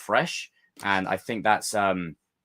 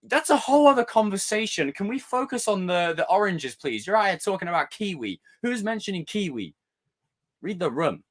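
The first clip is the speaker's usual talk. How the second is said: higher, exasperated lecturing; theatrical exasperation; 9 semitones higher than usual. Pretend. Theatrically scolding a Twitter nitpicker, pitched high. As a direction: scold them like a dramatic diva. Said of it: "I get very theatrical".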